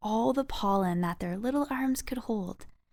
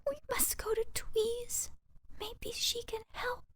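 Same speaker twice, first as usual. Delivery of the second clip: very high, soft, breathy squeak; hushed, hopeful little voice; whispered mouse; 8 dB quieter than usual. Acted. Freezing mouse suggesting going to Tweez, whispered.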